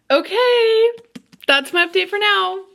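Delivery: high, bright-pitched excited wrap-up; giddy sign-off. Real, not acted. Reader ends her update on the delivery, giddy. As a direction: sing out the okay, then sign off high. Pulls herself together to sign off.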